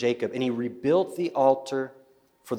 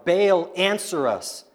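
mild retold shout; 6 semitones higher, 4 dB louder, slower than usual.

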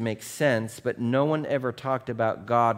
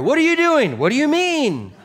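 high, loud mock parent outburst; hammed-up horrified parent; alarmed parent; 6 dB louder than usual. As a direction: yell at the kid in shock. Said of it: "parent finding kid misbehaving".

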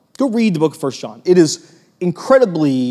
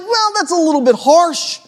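mock offended protest; 12 semitones higher than usual. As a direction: play the offended churchgoer. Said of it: "you'd be like".

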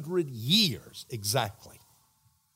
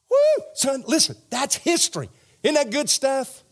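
delighted whoop; 10 semitones higher, 4 dB louder than usual. Real, not acted. Preacher whoops with delight at history.

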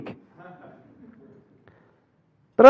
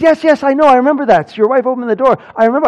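voicing the driver; 9 semitones higher, 3 dB louder, faster than usual.

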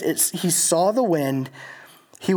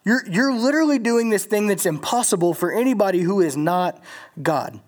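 normal talking voice

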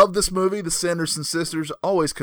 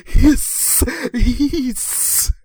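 vampire hiss; 10 semitones higher, 6 dB louder than usual. Acted. Vampire threatened with sunlight.

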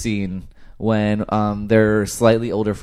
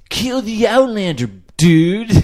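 high, loud bro-voiced villain; Malachi; 11 semitones higher, 7 dB louder, slower than usual. Showing off a Malachi accent that comes out bro, loud and high. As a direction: ham it up as a Californian dude. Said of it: "I'm such a bro".

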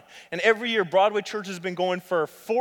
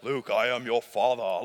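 deep villain impression, much lower; Darth Vader; 9 semitones lower than usual. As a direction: do a low movie-villain voice, darkly. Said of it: "does he sound like Darth Vader?".

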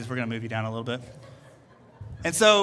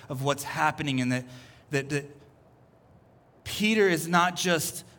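ordinary talk, nothing marked

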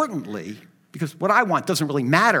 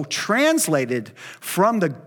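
usual speaking voice

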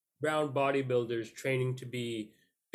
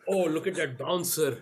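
put-on Indian accent; 3 semitones higher than usual.

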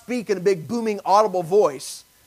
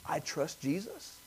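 much softer, slow and hushed; soft simple trust; 5 semitones lower, 13 dB quieter, slower than usual. Retold or imitated. Believer quietly voicing simple trust, soft and slow. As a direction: say it slowly and softly, like a quiet vow. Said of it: "I just simply say".

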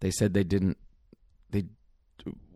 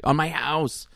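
high character voice; 12 semitones higher, slower than usual. Acted. Co-host corrects the actor's big line, high drawn-out impression. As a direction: stretch each word in a high put-on voice. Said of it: "'Cause what does he say?".

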